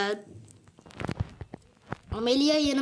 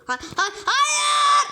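high, loud laughing no; panicked laugh, shrieking no; laughing shout; 13 semitones higher, 5 dB louder, much slower than usual. Real, not acted. Gamer yelping at his character, laughing shout.

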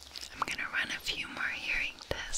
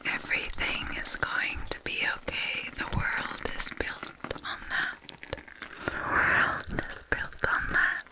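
calm reassuring ASMR whisper; soothing whisper; 2 semitones higher than usual. Acted.

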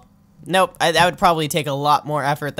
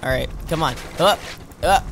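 ordinary talk

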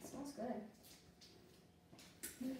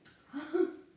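laughing; 4 semitones higher, 7 dB louder than usual. Real, not acted. Laughing after eating.

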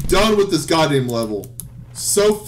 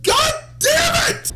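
very high, drawn-out curse; real shrieked outburst; enraged scream; slower than usual. Real, not acted. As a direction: scream it in sheer frustration. Failing again in the game.

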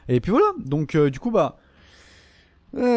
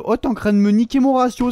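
usual speaking voice

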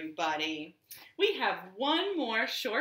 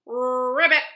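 loud, higher rolled ribbits; pig's frog croak; 5 semitones higher, 9 dB louder than usual. A narrator's reading. Little pig's only answer to the frogs, loud croaking. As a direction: croak each ribbit out, well separated. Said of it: "all the little pig said was".

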